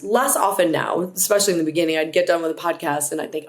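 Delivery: ordinary delivery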